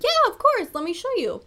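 high, bright customer-service voice; sing-song store clerk yes; mock chipper yes. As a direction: play up the eager helper. Mock cheerful employee reply.

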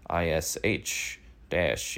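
slow, spelled-out excuse; mock Santos, spelling it out slowly. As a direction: drag it out like a lame excuse. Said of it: "he's like".

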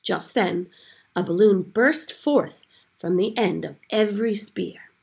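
ordinary delivery

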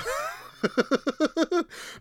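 high, rising giggle. Real, not acted. Laughing at his own cussing rant, high giggle. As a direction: break into a rapid high giggle.

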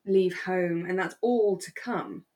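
ordinary talk, nothing marked

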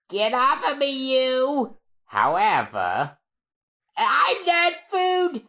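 high, indignant squeal; squeaky Chopper protest. Acted.

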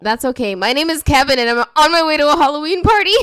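nun revealing she is Kevin; punchline. Acted. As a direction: rush the reveal with glee.

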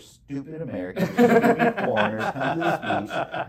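laughing as it dawns on him; laughing realization. Retold or imitated. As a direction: laugh at the stupid foreigners, yourself included. Realizing what the show is.

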